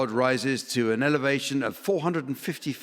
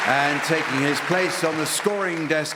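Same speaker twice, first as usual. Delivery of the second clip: raised, upbeat announcer voice to introduce the panel; announcer intro; 4 semitones higher than usual. Acted. Host welcoming the panel to the audience, bright announcer read. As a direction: present the teams like a game show host.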